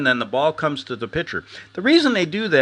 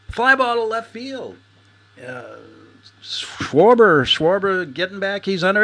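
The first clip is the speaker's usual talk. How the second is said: put-on high, slow old-time announcer call; radio play-by-play; 6 semitones higher than usual. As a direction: call it like a baseball radio man. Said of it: "it's the old baseball expression".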